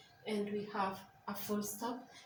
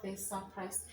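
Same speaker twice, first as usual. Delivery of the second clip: usual speaking voice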